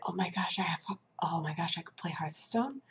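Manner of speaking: breathless whisper of disbelief; hushed excited whisper. Retold or imitated. To herself over a new game key, a hushed whisper. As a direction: whisper it like a guilty secret.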